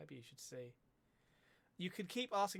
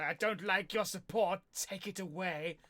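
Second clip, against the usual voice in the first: mock ungrateful; 4 semitones higher, 8 dB louder, slower than usual. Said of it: "I'm not gonna say".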